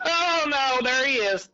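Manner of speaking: high, startled "oh no"; retold alarm. Hunter spotting a cottonmouth on the bank, high alarmed cry. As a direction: squeak it out as the snake shows up. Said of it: "and I'm going".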